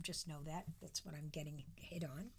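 hushed confiding whisper. Real, not acted. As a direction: let it slip in a whisper. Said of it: "just between you and me".

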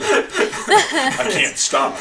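laughing through the words; high, giggling delivery. Real, not acted. Laughing reply to monk story.

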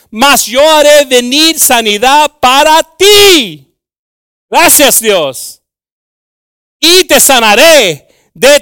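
loud, high, impassioned scripture preaching; full-force preaching. Real, not acted. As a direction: belt out the verse to the congregation.

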